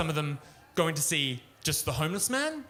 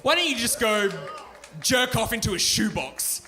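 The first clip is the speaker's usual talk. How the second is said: loud, higher comic yell; mock shout; 6 semitones higher, 5 dB louder than usual. Comic yelling at the ad guy, hammed-up shout. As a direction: blast it at him.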